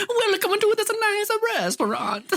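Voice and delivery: very high, laughing mock Italian waiter; squeaky Italian restaurant host mouse; fancy Italian mouse. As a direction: welcome guests like a tiny Italian maître d'. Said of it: "Italian fancy mouse".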